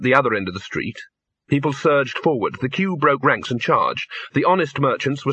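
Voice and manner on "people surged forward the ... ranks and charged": plain reading voice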